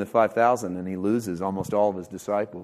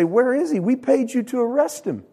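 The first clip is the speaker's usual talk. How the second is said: demanding Pharisees; 12 semitones higher than usual. Retold or imitated. Pharisees to the soldiers, a high indignant demand. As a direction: play the Pharisees scolding their men, pitched high. Voicing angry Pharisees in the retelling.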